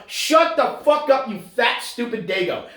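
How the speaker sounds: hammed-up furious telling-off; exasperated shout. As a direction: yell it slowly at an imagined pest. Ranting back at imagined Italian fans.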